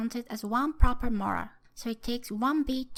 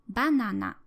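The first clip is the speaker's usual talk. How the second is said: banana high on BA, falling after it; high-first pitch accent; 5 dB louder than usual. Shown as a demonstration. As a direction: correct Japanese accent, banana starts high and drops. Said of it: "with the high pitch at the beginning of the word".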